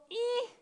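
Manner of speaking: a small tight worried noise; anxious squeak. Acted. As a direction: squeak out the unease. Describing feeling uneasy.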